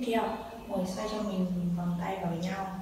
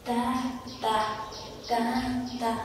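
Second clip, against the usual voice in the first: slow sung count along the music; 2 semitones higher, slower than usual. Shown as a demonstration. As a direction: sing the beats out slowly. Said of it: "Chậm nhá".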